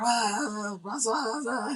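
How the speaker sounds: high, strained cry for her; panicked call. Retold or imitated. Traveler calling for his vanished friend in the water, panicked. Richard calls for missing Francoise.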